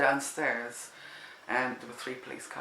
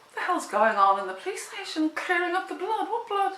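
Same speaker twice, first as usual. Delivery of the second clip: high, shocked disbelief; can't believe what you just heard; incredulous thought; 5 dB louder than usual. Retold or imitated. Woman waiting outside the station, high and incredulous. Inner thought on hearing about blood.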